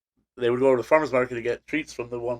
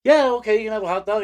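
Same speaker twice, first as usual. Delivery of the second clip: higher, eager dog voice; voicing the dog; 8 semitones higher than usual. Dog answering the firemen offering a hot dog, higher eager voice. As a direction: play the dog happily accepting. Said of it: "And be like".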